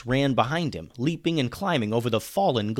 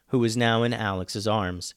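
ordinary delivery, nothing marked

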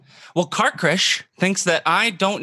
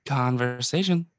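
low, slow trailing word; sleepy drop-in; 5 semitones lower, slower than usual. Acted. Brother dropping in slowly on the intro's last word. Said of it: "fall asleep in the middle".

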